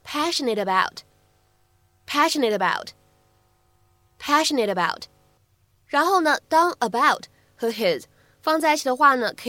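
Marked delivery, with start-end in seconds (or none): 0.0-5.0 "passionate about" linked, the t flapped into "passionatabout"; "passionate about" as one word with a flapped t; linking with flap T. Shown as a demonstration. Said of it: "可以读成".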